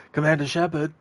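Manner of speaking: high-pitched mock call, like hailing someone; imitated yelling. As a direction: imitate a woman repeatedly calling out for attention. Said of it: "she would always yell at you".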